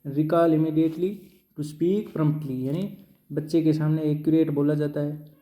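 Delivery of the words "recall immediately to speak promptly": dictating the heading at very slow pace; drawn out word by word for note-taking; teacher reading a heading slowly for notes. Shown as a demonstration.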